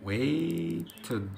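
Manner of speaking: "waited" with a long stretched "waaay", then "ted"; separate "id" syllable. Shown as a demonstration. Teacher writing out the answer, drawn-out separate "id" syllable. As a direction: draw out "waited" softly into two syllables. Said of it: "waited قبلها تي هتنطق اد".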